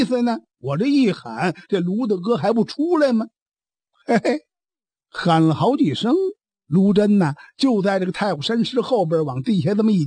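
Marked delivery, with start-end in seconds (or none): none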